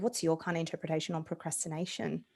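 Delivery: normal talking voice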